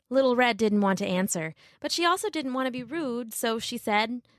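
ordinary delivery, nothing marked

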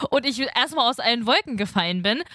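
ordinary talk, nothing marked

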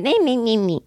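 high, mocking me-me-me chant; mock her own selfishness; self-absorbed. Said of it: "And I'm".

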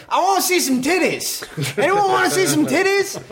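high, rowdy emcee call; hype man. Retold or imitated. Emcee working the crowd for cheers, rowdy. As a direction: rile up a concert crowd. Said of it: "pumping up the crowd".

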